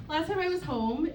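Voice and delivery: ordinary talk, nothing marked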